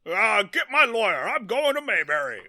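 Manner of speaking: high, loud blustering rich guy; hammed-up outraged big shot; Jay Howard. Rich guy gives in and calls for his lawyer, hammed-up bluster. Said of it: "Jay Howard is like".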